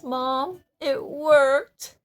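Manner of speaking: high, loud, delighted report; mock-excited, voice rising to a loud peak; imitating happy son. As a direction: act out a son's thrilled success. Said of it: "I was so happy".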